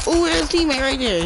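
high urgent warning; excited callout. Real, not acted. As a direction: shout the spotted enemy.

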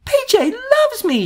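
high delighted exclamation; happy little bunny crying out; joyful cry. A narrator's reading. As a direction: burst out with joy. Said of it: "she cried".

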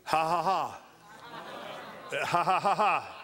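ha ha ha acting out God laughing; demonstrated laughter. Shown as a demonstration. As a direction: show the congregation holy laughter. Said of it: "They're laughing".

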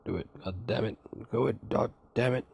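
understated fake-angry accent; half-hearted anger in accent. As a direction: try to curse angrily without losing the silly accent. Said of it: "hard to get angry in that accent".

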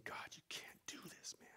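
whispered, broken plea to God. Retold or imitated. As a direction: breathe it out in despair. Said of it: "I was thinking".

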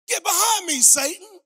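loud, high commanding rebuke; rebuking shout. Retold or imitated. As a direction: thunder it at Satan. Jesus rebuking Peter, shouted.

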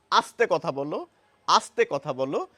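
আস্তে with a clean dental s, ending in a rise. Shown as a demonstration. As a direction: repeat আস্তে কথা বলো gently, crisp s. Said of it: "দন্ত শয়ের উচ্চারণ করছি".

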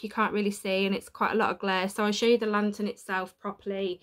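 usual speaking voice

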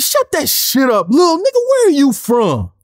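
imitating a fed-up castmate's outburst. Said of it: "fed the fuck up".

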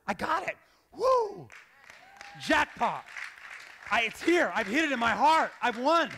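acted jackpot whoop, voice leaping; elated. Newly secure believer hitting the jackpot, excited cheer. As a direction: exult like a winner, loud by the end.

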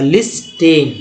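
लिस्टेन with the t sounded; mock the mistake: लिस्टेन with a hard t; लिस्टेन said lis-ten, t not silent; imitating wrong pronunciation. Shown as a demonstration. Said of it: "टीएन को रखते हैं ध्यान में".

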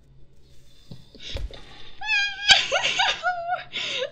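high, loud nervous shriek of laughter; a scared gamer's loud panicky laugh. Real, not acted. Gamer scared by Minecraft shrieks with laughter.

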